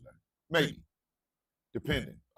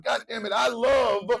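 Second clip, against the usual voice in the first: higher, louder forceful declaration; high and strong; 5 semitones higher, 3 dB louder than usual. Real, not acted. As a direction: belt it out like angry love. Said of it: "My voice is gonna get real high and real strong in anger".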